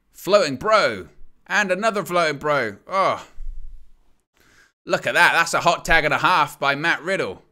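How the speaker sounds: rising, hyped wrestling call; excited play-by-play. Real, not acted. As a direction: call the big moves as they hit. Live play-by-play of tag match.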